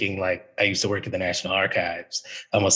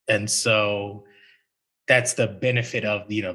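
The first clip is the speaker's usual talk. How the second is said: ordinary delivery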